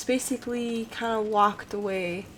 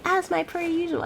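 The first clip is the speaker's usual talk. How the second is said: "As my per usual" said higher, teasing; mocking her own phrase; 9 semitones higher than usual. Shown as a demonstration. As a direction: mimic your own catchphrase, mocking. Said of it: "Me saying".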